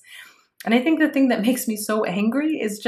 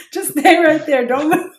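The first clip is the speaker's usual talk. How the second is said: laughing, louder cop command; mock police; 7 semitones higher, 7 dB louder than usual. Co-host jokes, plays a cop shouting orders. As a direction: play a cop ordering a suspect, half laughing. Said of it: "You sound like the police".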